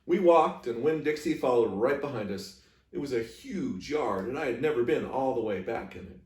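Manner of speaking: ordinary delivery, nothing marked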